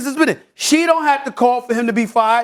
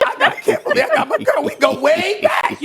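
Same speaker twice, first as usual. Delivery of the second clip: gleeful boast; 10 semitones higher than usual. Pretend. Gleeful boast about Kimberly.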